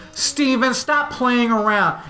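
put-on high, slow scolding of a stuck kid; a teacher drawing out a warning; stern teacher. Teacher scolding Steven stuck to the chair, high and slow.

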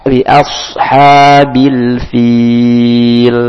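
bi ashabil fil stretched very slowly. Shown as a demonstration. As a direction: draw the words out slowly. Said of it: "bukan fil terlalu panjang". (dibaca sangat lambat dan panjang)

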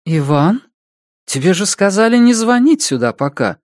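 high, mildly reproachful woman; woman on phone. A narrator's reading. Woman scolds Иван for calling, high reproachful voice.

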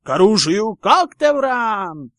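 loud battle cry, house name stretched high; shouted. A narrator's reading. Michael calling his house to arms, shouting. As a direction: bellow a drawn-out call to arms. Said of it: "выкрикнул Майкл Корнер".

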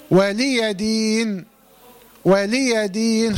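slow chanted ولي دين as "waliya deen", a short a after the y; open ya. Shown as a demonstration. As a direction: recite ولي دين with the ya vowelled and a long final "deen". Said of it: "خلي بالك إن الياء إيه؟".

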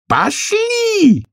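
very high, imperious bellow; roar. A narrator's reading. Queen roars for Alice to come along, very high. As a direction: roar the command at the top of the range. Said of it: "взревела королева".

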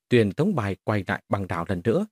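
plain reading voice

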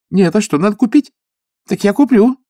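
high, loud flustered gabble; pilgrim woman eager to please, high and loud; flustered chatter. Acted. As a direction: rattle it off anxiously. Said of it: "в волнении затараторила женщина".

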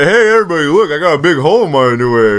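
high, loud mocking imitation; mock him in a high loud voice; mocking friend. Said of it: "you literally said".

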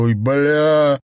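loud, high yelp as he falls; pained outburst. Acted. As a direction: cry out on hitting the step. Falls onto the stairs. (вскрик при падении)

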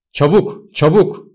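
high, loud urgent cry; urgent shout. A narrator's reading. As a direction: call out in alarm to hurry them. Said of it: "diye bağırdı Benjamin".

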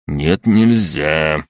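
drawn-out tired no; slow weary refusal. Acted. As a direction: drag out the refusal.

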